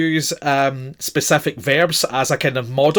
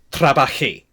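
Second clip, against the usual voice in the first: "trabajé" with JÉ stressed, falling at the end; stress on the final syllable. Shown as a demonstration. Teacher repeats the yo form, final stress on "trabajé". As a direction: put the weight on the end: tra-ba-JÉ. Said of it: "the stress is always on the final syllable".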